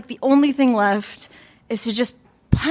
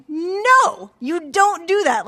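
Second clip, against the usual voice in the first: higher, stretched-out "no" then firm warning; direct firm refusal; 6 semitones higher, much slower than usual. Retold or imitated. Woman warning off a drunk man who touched her, firm. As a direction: put your foot down, retold. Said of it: "I'm saying directly to him".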